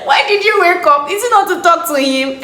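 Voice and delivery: mock scolding of herself. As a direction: tell yourself off, half laughing. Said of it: "I just said to myself".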